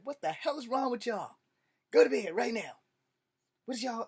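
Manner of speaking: loud, high angry father yelling; angry dad. Retold or imitated. Father yelling at his screaming kids at night, angry. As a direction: bark at the kids to get to bed. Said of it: "my dad jumped out the bed and said".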